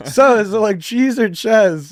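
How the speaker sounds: high, emphatic bad British accent; [name]. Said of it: "like, my horrible British accent".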